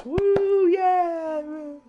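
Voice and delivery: high, whooping cheer as a one-person crowd; mock crowd cheer. Thanking a guest at the interview's end, with a whooping mock cheer. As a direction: cheer like a whole audience is clapping. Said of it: "applauding sound effects".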